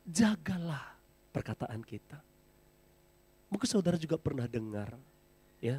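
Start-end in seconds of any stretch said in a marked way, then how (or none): 0.1-0.9 whispered. Real, not acted. Pastor whispers key word.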